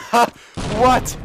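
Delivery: loud, high-pitched disbelief; amazed outburst. Real, not acted. Gamer amazed at own play, loud high disbelief.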